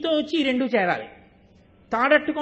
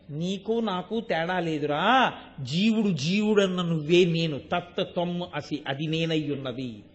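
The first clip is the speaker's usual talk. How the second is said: divine reassurance; 7 semitones lower, 3 dB quieter, slower than usual. Retold or imitated. God speaking, soft and slow.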